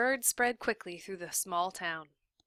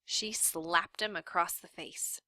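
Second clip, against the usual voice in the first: ordinary delivery, nothing marked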